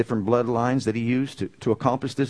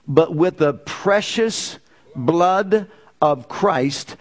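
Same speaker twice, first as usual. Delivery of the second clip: measured, raised scripture-reading voice; 4 semitones higher than usual. Acted. Preacher reading First Peter to the church, solemn read. Reads scripture aloud to congregation.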